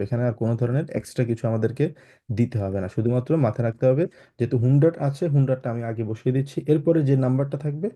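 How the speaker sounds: ordinary talk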